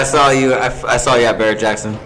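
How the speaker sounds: normal talking voice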